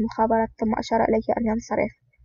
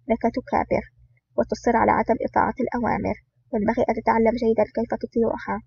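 plain reading voice

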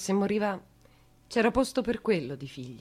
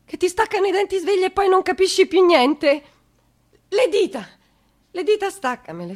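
higher, louder frantic plea; frantic cry; 10 semitones higher, 9 dB louder than usual. Acted. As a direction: push it out in a rush of desperation. Frantic pleading. (supplica frenetica, più alta e forte)

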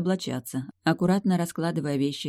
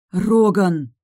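Роган much louder, sharper, falling hard; even more indignant; 5 semitones higher, 8 dB louder than usual. Acted. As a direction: scold him harder by name. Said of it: "ещё больше возмутилась я".

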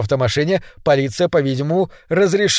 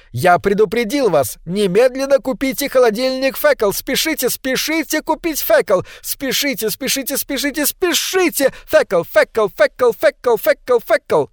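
high, furious yelled ad; furious yelling; 9 semitones higher than usual. A narrator's reading. Loudspeaker yells furiously at the neighbours to hurry. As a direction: scream the ad at the whole street. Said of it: "Голос продолжал яростно выкрикивать".

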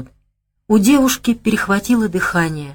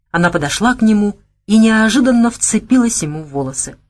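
ordinary delivery, nothing marked

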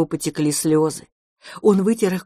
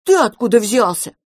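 higher, louder grumpy character voice; scowling little grump; sullen; 5 semitones higher, 5 dB louder than usual. A narrator's reading. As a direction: demand it sullenly. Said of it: "хмуро спросил мышевраг".

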